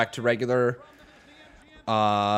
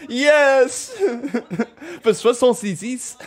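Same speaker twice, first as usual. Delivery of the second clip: high-pitched words through laughter; delighted laughing; 9 semitones higher, 5 dB louder, slower than usual. Real, not acted. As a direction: crow it while cracking up. Cracking up at French donation voice.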